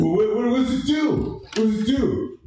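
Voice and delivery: high, insistent demanding; frantic general. Retold or imitated. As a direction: stammer and push the question hard. General demanding answer from private.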